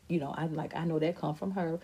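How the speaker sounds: ordinary delivery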